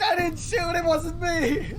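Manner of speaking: very high-pitched whining protest; mock panicked denial. Cobblepot on the floor protesting, high mock panic. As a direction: plead innocence in a comic squeal.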